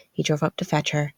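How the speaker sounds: ordinary delivery, nothing marked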